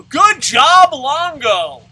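very loud, high shout; shouted cheer. Real, not acted. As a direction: yell it out to the player mid-game. Shouts at player in the game.

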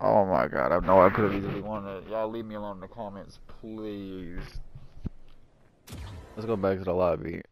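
low, fading defeated murmur; small, deflated plea; dejected. Real, not acted. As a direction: trail away very softly in defeat. Dejected after losing the game.

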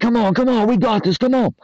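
high-energy angry hype, pushing yourself on; angry pump-up. Retold or imitated. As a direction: ramp yourself up like a coach yelling in your head. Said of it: "use anger and ramp yourself up and be like".

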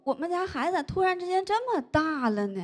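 ordinary talk, nothing marked

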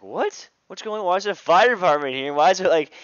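high, very loud disbelieving questions; mock alarmed parents. Parents woken by fire department outside, mock alarm. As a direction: blurt it out in hammed-up panic.

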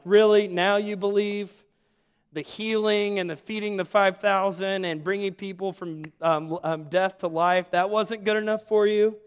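mock frustration, really now you believe; frustrated Jesus. Preacher voicing Jesus frustrated at the disciples, exasperated disbelief. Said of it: "Jesus is like".

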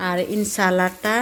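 slow, careful teaching of ইনসালাতা; slow Italian vocabulary lesson; Italian word taught slowly. Shown as a demonstration. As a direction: spell out ইনসালাতা syllable by syllable, slowly. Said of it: "এটা আমরা lettuce পাতা বলি".

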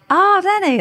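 high-pitched, loud mock welcome; delighted greeting. Lovely man greets the officer at his door, delighted. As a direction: greet with an exaggerated high lilt.

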